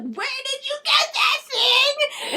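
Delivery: high, loud shout through laughter; a high, loud, giggling outburst; laughing shriek. Acted. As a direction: yell it while cracking up. Clary shrieks amid laughter.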